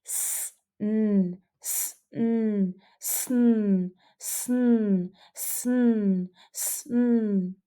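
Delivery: "sn" sounded out and repeated; blend drill. Shown as a demonstration. Teacher sounding out the blend for students to copy. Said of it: "What about this one".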